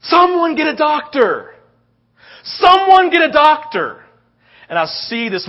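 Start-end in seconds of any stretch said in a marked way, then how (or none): 0.0-4.0 high, loud, drawn-out yell; re-enacted emergency shout; urgent cry for help. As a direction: scream for a doctor across the field.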